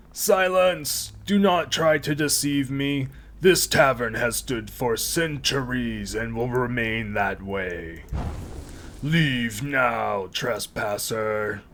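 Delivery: slow, booming spirit voice; ancient rasping undead king. Acted. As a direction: loom over the intruder, slow and loud. Spirit orders intruder out.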